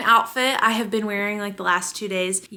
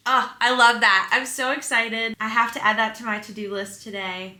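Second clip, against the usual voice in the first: usual speaking voice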